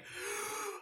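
very high sharp gasp; excited gasp. Retold or imitated. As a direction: gasp in delight. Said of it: "And I was like".

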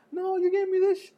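crying student begging; whimpering kid. Retold or imitated. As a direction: whine like a kid about to cry.

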